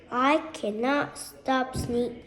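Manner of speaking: ordinary delivery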